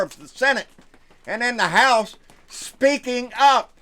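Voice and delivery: rally the viewers like a fired-up preacher; fired-up rant. Real, not acted. Vlogger to his viewers about Congress, loud fired-up ranting.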